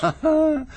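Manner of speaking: a bright, high delighted laugh; a short high burst of laughter; amused laugh. Acted. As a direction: laugh with real pleasure at the cleverness. Amused at the detective work.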